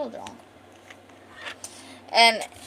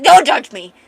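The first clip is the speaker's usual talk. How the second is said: embarrassed retort; 4 semitones higher, 17 dB louder than usual. Retold or imitated.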